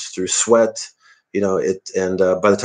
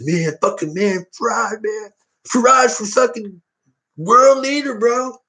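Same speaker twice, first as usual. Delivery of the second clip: hammed-up rock star gushing, rising to a yell; stoner rocker; 12 semitones higher, slower than usual. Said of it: "All the artists would have said".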